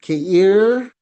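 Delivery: "Keir" loud and sweeping upward; emphatic rising "Keir"; accented vowel. Shown as a demonstration. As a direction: push "Keir" up in pitch on its vowel. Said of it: "nandun din yung emphasis niya".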